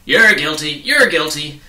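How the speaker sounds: loud barking accusation; bellowing policeman voice; shouting. Acted. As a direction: shout it at everyone passing by. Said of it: "stopping only long enough to shout".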